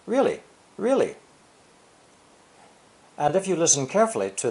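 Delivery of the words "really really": both words stressed, high and falling; emphatic really really. Shown as a demonstration. Teacher showing how "really, really" stresses the verb. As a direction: lean hard on "really, really" with a high falling pitch. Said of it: "like saying".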